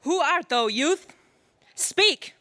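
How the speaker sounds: high, forceful command; sharp demand. Acted. Lion Queen demanding the stranger speak, sharp and forceful. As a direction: demand he answer now.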